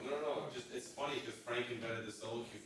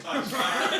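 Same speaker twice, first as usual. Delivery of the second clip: laughing; 12 dB louder than usual. Retold or imitated. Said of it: "I was like".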